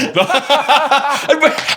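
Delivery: high, loud burst of laughter; big laugh. Real, not acted. Co-host teased about his voice, big laugh.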